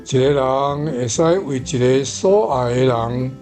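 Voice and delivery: usual speaking voice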